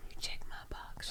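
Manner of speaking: soft teasing whisper. Acted. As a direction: whisper it back playfully. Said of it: "Why are you whispering?".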